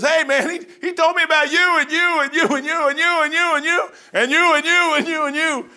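high, loud rapid-fire excitement; excited woman. Retold or imitated. The woman telling the whole town: high loud excited rattle. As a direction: point at everyone, thrilled.

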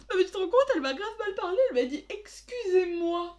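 high, loud, outraged mock whine; mocking indignant woman. Sister parodies the angry lady's complaint, high mocking whine. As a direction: ham up her fake outrage. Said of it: "C'était ultra drôle".

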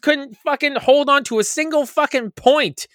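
high, exasperated rant; heated rant. Real, not acted. To his co-hosts, a heated rant about the Ravens. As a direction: vent about the Ravens blowing the points. Ranting about fantasy losses.

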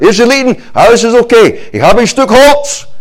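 high, loud forced cheer; Moses hammed-up. Moses announcing his stick to the people, hammed-up cheer. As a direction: bluff confidently about a stick. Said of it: "hat gesagt".

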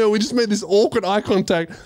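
ordinary talk

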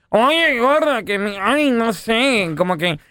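coy wife voice. Acted. Husband voices flirty wife.

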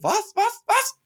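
high-pitched imitation of a flustered woman; mock alarm, voice shooting up; startled, distraught. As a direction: mimic someone spooked by a shout. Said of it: "sie so richtig verstört".

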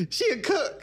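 high squealing laughter; shrieking laugh. Real, not acted.

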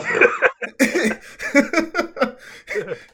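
big burst of real laughter; loud laugh. Real, not acted. Podcast host laughing at his own retelling, big burst of laughter. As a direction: crack up at the punchline.

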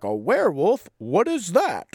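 imitated wide-eyed question, pitch swooping up; hammed-up puzzlement; mock clueless surprise. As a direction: play him naively baffled. Acts out his clueless question.

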